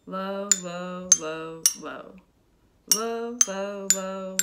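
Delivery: level chant on a low note; low sound demo. Shown as a demonstration. Teacher matches the xylophone's low sound, chanting low. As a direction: intone each word low and steady. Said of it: "speaking of high and low sounds".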